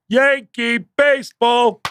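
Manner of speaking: high, drawn-out mock yell; crowd heckler call. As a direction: imitate a random fan calling out, stretched and high. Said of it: "someone in a crowd will go".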